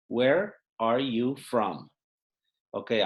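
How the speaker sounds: ordinary delivery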